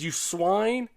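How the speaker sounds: high-pitched curse, rising in outrage; angry insult. A narrator's reading. Soldier kicking the man in the ribs, cursing angrily.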